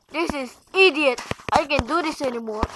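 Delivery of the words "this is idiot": ordinary talk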